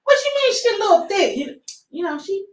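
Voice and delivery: high, startled wait a minute; alarmed mom. Retold or imitated. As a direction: protective mother caught off guard. Mom reacting to son's crush.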